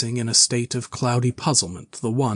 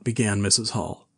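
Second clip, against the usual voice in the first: ordinary delivery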